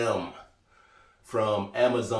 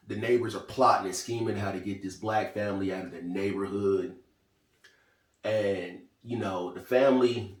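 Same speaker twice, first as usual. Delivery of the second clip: ordinary delivery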